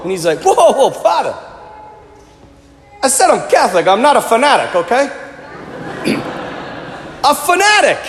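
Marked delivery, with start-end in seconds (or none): 0.4-5.1 protesting mock Jersey Italian accent, pitched up at first. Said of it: "a Jersey Italian accent for you". 7.2-8.0 incredulous echo. Real, not acted. Preacher echoes the man's word incredulously.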